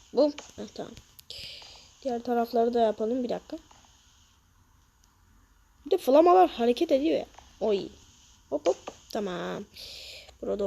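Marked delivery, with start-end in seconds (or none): none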